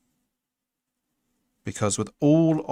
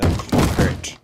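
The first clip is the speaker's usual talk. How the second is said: whispered Alan Partridge take on "Pocket", louder and breathy; Alan Partridge accent; 6 dB louder than usual. Retold or imitated. Streamer redoes the joke to chat in an Alan Partridge accent. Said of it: "in an Alan Partridge accent".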